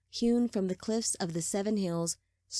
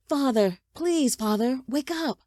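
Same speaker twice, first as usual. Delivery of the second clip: insistent, rising call to wake someone; persistent plea; 6 semitones higher, 5 dB louder than usual. A narrator's reading. Son trying to rouse his father, urgent persistent plea. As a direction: plead louder, climbing higher. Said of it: "the voice was persistent".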